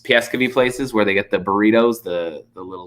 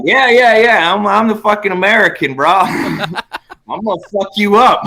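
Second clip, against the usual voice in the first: much higher, louder hammed-up tough-guy taunt with laughter; hyped-up fight taunt; 8 semitones higher, 3 dB louder than usual. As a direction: pump yourself up before a fight, laughing. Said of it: "I'm just like".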